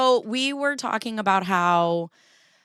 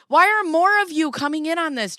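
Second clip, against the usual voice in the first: high, loud mock outrage; indignant; 9 semitones higher, 5 dB louder than usual. Real, not acted. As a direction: protest to the chat, outraged. Indignant at chat comments.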